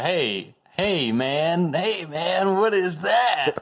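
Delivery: stoner voice. Acted. Acted stoner dialogue.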